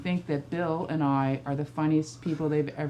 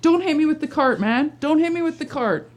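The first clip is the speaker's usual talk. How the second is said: higher and louder each time, pleading; mock panicked plea; 10 semitones higher, 6 dB louder than usual. Mother pleading with her son pushing the cart, higher and louder. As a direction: keep rising in pitch with every repeat. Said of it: "I'm like".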